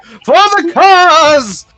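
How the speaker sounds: heroic battle cry. Acted. Betsy the horse charging the bandit leader, heroic high battle cry. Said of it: "Betsy is like".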